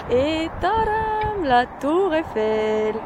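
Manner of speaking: « tadam » très aigu et étiré; tadam enthousiaste. Real, not acted. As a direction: annonce ravie, voix qui s'envole. Dévoile la tour Eiffel. (dévoile la tour Eiffel, tout excitée)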